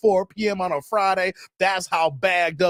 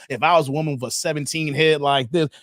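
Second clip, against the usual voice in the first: normal talking voice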